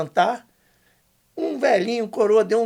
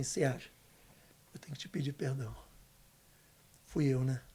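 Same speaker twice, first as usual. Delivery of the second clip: father's soft confession; 6 semitones lower, 10 dB quieter than usual. Retold or imitated. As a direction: quietly voice the father asking forgiveness. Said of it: "ele olhou dentro do meu olho e falou assim". (confissão baixa do pai, em voz contida)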